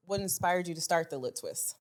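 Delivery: usual speaking voice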